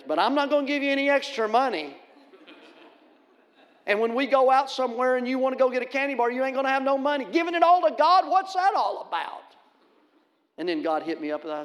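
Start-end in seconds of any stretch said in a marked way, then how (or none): 0.0-9.4 loud, high fatherly lecture; lecturing father. Retold or imitated. Father warns son about giving all his money away. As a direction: wag the finger like a dad. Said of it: "the fatherly thing".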